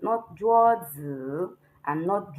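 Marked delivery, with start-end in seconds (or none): 0.4-1.5 "juozi" with zi dipping then rising; wrong third tone. Shown as a demonstration. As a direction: slowly dip and lift the long zi. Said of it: "but Z I has no tone".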